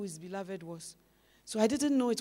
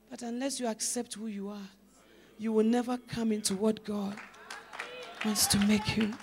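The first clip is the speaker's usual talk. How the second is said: ordinary delivery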